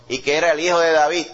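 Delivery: ordinary delivery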